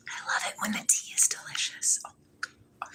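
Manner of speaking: soft whisper of delight; whispered tea bliss. Real, not acted. Whispering to viewers over her tea, savouring it. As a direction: breathe the words out in a whisper.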